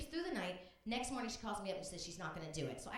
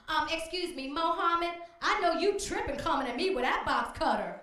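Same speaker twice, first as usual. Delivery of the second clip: loud, much higher sassy scolding; Missus Washington; 7 semitones higher, 11 dB louder than usual. Retold or imitated. Bus driver on a plane scolding a hijacker, loud and sassy. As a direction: put the hijacker in his place, loudly. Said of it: "hear Missus Washington go".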